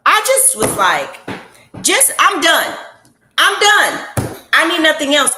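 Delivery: loud, moved, about to cry; overwhelmed. Real, not acted. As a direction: voice lifted and full, nearly in tears. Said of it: "I may even cry right now".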